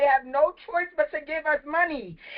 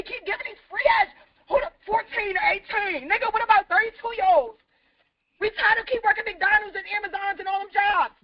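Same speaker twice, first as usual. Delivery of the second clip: higher-pitched, heated complaint; raised voice, frustrated; 3 semitones higher than usual. Real, not acted. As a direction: protest in real frustration. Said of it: "raising my voice".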